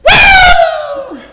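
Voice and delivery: a sudden high falsetto whoop; preacher's whoop. Real, not acted. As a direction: let out a joyful high holler. Preacher whoops mid-sermon.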